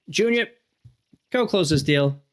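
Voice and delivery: high, loud call of "Junior", then a brisk order; a manager hollering across the lot; boss calling out. Retold or imitated. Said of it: "they called me Junior".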